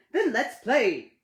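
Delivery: loud, slow booming call; Ted booming to his friend. Acted. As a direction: boom it out, big and slow. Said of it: "Ted boomed".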